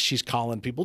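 ordinary delivery

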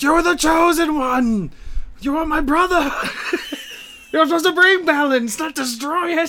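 high, loud, wailing betrayal speech; mock dramatic hero speech. Podcast cohost hams a betrayed brother's cry, high and loud. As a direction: ham it up like a betrayed Jedi.

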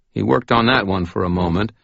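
plain reading voice

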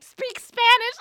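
loud, high mock-angry yell. Annoyed lady yelling at a worker, loud mock anger. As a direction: shout it like she caught you lying. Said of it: "she got louder and angrier".

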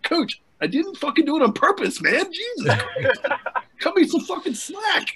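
high-pitched pleading through laughter; squeaky, helpless mock plea; retold laughing protest. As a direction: protest while cracking up. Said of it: "I started laughing".

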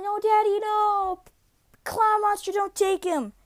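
put-on high, pleading child's wail; crying child. Son begging the clown monster to spare dad, crying. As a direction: beg the monster through imitated tears. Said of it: "I start crying".

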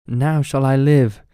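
loud, high joyful cry. A narrator's reading. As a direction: cry out with joy as branches spread. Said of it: "cried the tree joyfully".

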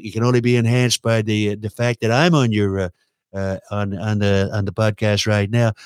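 ordinary talk, nothing marked

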